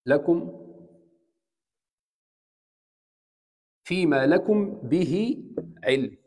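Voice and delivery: slow recited لكم به علم with held غنة; Quran recitation. Shown as a demonstration. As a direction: recite slowly, tajweed style. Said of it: "خلينا نشوف مثال". (تلاوة بطيئة مجودة)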